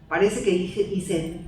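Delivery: ordinary talk, nothing marked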